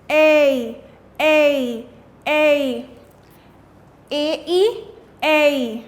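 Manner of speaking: A called out loud, repeated; drilled long A. Shown as a demonstration. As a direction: call A out in a drill. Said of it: "and the action is".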